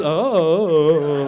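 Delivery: loud, vacant drawn-out "oh"; oblivious boy, imitated; spaced-out boy. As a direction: play the dazed son. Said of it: "boys get a little spaced out".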